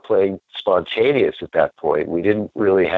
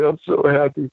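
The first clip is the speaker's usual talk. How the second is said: high, choked-up joy; happy crying friend; 10 semitones higher than usual. Retold or imitated. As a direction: voice it high as he weeps with happiness. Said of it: "he was like".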